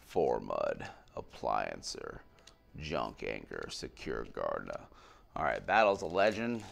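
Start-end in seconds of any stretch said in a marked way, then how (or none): 0.1-2.2 "Four Mud, Appliancer" read much lower and slow; deep card voice. Pretend. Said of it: "voice changes on every card". 2.8-4.8 much lower, slow, mock-dramatic card names; deep card voice. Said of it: "voice changes on every card".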